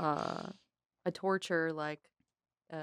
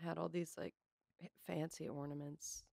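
unvoiced quick whisper; 13 dB quieter than usual. Real, not acted. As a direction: whisper the confession. Secret confession.

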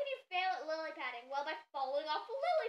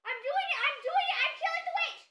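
high, loud thrilled shouting; frantic high-pitched exclaiming; excited triumph; 10 semitones higher, 6 dB louder than usual. Real, not acted. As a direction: build to a shriek of victory. Winning the witch fight.

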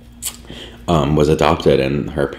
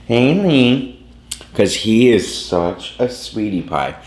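pet talk; 7 semitones higher, slower than usual. Real, not acted. Greeting her cat Liam on camera, high cooing baby talk. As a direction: coo at the cat sweetly.

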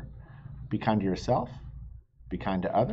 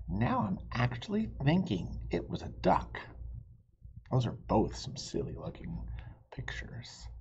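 whisper; 8 dB quieter than usual. Acted. Quiet doubt ending.

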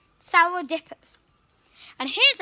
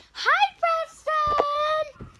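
high sing-song greeting; 10 semitones higher, much slower than usual. Real, not acted. Greeting someone by name.

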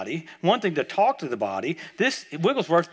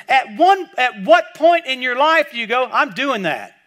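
loud, high, driving preaching; full-force preaching; 7 semitones higher, 7 dB louder than usual. Acted. A preacher rousing his congregation, loud, high and driving. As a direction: fire up the congregation and push every line.

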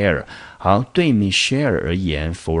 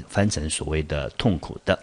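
ordinary talk, nothing marked

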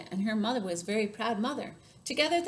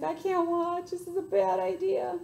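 high-pitched, worried lion voice; squeamish lion; 9 semitones higher, 3 dB louder than usual. Acted. Lion closing his eyes at the bump, high and squeamish. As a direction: whine it like you can't bear to look. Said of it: "The lion's closing his eyes".

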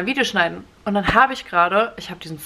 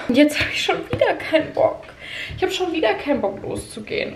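whiny; 7 semitones higher than usual. Real, not acted. Whining about not wanting pole.

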